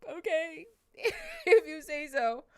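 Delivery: high, slow, half-laughing disbelief; a teary, unconvinced okay; doubtful okay. Retold or imitated. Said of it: "And I was like".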